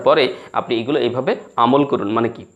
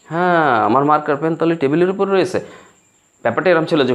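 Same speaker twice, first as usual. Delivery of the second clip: ordinary talk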